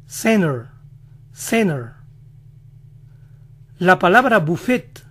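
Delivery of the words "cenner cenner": "Center" with silent T, like "sener"; American "Center", N swallowing the T; T dropped after N. Shown as a demonstration. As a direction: say "Center" as "SEN-er", no T. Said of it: "puede dejar de pronunciarse así".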